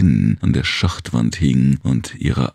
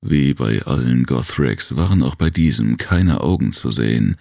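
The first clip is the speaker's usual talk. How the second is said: plain reading voice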